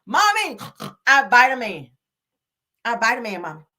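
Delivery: imitating a toddler. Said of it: "I told y'all his ass".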